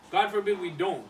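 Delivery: normal talking voice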